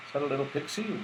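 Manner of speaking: usual speaking voice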